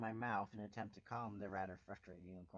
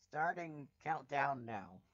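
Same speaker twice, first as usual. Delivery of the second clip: slow deliberate announcement; 5 dB louder, slower than usual. Acted.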